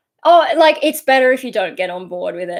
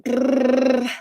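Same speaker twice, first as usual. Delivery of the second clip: pitched-up filler trill; lip-trill brr; 4 semitones higher than usual. Real, not acted. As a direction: let out a rolled brrr. Makes a noise between topics.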